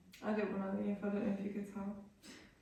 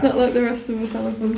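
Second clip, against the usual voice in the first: laughing; 20 dB louder than usual. Real, not acted. Teasing friend, laughing.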